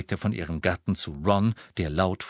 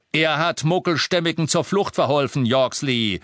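higher, loud shout; 6 semitones higher, 5 dB louder than usual. Acted. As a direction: shout it to Yorksley. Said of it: "schrie Harry".

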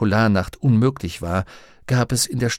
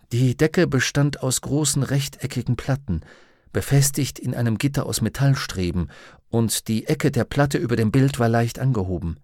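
ordinary delivery

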